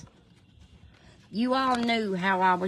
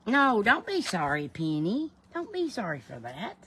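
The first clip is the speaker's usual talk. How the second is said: ordinary delivery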